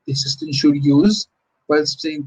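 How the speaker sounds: ordinary talk, nothing marked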